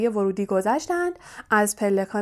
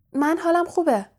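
startled protest; 7 semitones higher than usual. A narrator's reading. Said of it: "هری از جایش پرید و گفت".

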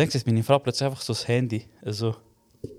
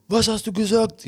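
mock angry father; 8 semitones higher than usual. Father again, higher.

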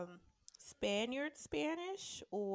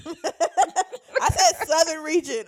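laughing; 7 semitones higher, 14 dB louder, much slower than usual. Real, not acted. Laughing, correcting a friend.

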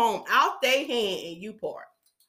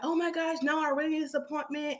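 higher, softer pleading; mock complaint, pitched up; complaining client; 3 semitones higher, 6 dB quieter than usual. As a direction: imitate a client pleading for an appointment. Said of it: "she kept complaining".

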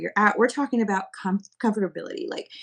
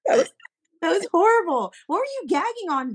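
excited; 9 semitones higher, 4 dB louder than usual. Real, not acted. Laughing disbelief at friend.

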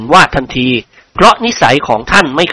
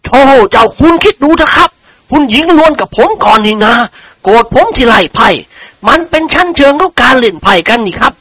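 angry; 7 semitones higher than usual. A narrator's reading. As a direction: complain hotly as the irritated lord. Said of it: "พูดขึ้นอย่างโมโห". (พูดอย่างโมโหเล็กน้อย)

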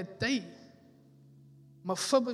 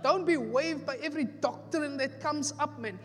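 higher, louder, driving sermon cadence; fiery pulpit exhortation; preaching at full force; 6 semitones higher, 5 dB louder than usual. Acted. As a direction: exhort the church, building each line.

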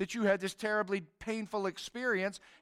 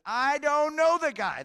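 louder, higher and slow, a gruff denial; angry denial; 7 semitones higher, 7 dB louder than usual. Retold or imitated. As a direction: snap it at the woman, mock angry. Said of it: "he cusses at this woman".

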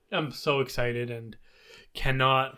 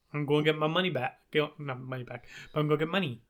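ordinary delivery

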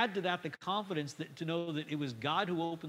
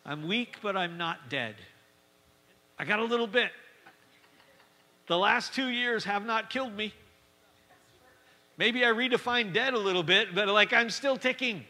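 stubborn survivor; 3 semitones higher, 6 dB louder than usual. Retold or imitated. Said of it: "Some of you going".

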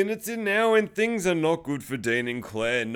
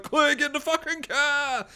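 high, strained acted shout; urgent yell; 10 semitones higher than usual. Dean to Claire mid-fight, high urgent yell. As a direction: cry out a desperate order.

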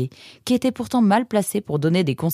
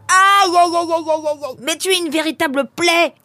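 high, loud yelps of pain, then a scolding cry; 13 semitones higher, 6 dB louder than usual. A narrator's reading. Nemrod, hit by the door, cries out at the pelé. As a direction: yelp after a door in the face. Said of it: "cria Nemrod au pelé".

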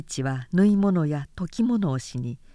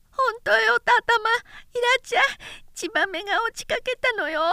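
put-on much higher, panting toddler calling; little boy urging his mother to come see the swallow, breathless; breathless lisping child; faster than usual. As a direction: tug at mother, out of breath and eager. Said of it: "せいせい息を切らして言った".

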